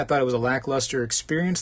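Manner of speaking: ordinary delivery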